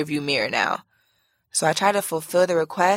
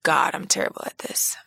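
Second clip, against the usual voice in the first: plain reading voice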